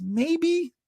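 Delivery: maybe sung high and falling; a teasing high falling maybe; doubtful maybe. Pretend. Musing whether Scandinavians bust balls, high falling maybe.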